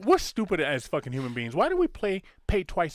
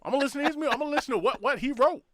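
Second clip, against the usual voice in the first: higher, louder hammed-up Southern hillbilly accent; 12 semitones higher, 6 dB louder, faster than usual. Mocking a fan to his cohost, hammed-up Southern hillbilly accent. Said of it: "I do love your, uh, your hillbilly Southern accent".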